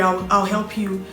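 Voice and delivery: normal talking voice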